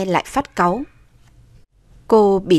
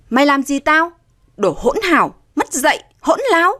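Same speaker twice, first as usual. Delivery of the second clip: higher-pitched angry insults; indignant scolding; 7 semitones higher than usual. A narrator's reading. Stepmother cursing her stepdaughter, indignant. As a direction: scold an insolent youngster.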